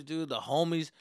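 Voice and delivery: ordinary talk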